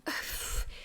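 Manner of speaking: soft exhale of relief; heavy sigh. Acted. Mom to son just home, heavy sigh. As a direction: let out a long, shaken-up sigh.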